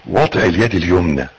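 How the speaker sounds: ordinary talk, nothing marked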